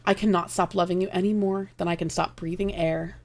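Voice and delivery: normal talking voice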